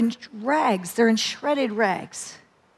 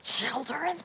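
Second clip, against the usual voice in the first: much softer, rising incredulous question; reluctant disbelief; 11 dB quieter than usual. Retold or imitated. Her flesh balking at children, soft incredulous question. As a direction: ask it like the idea appalls you. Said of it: "my flesh was like".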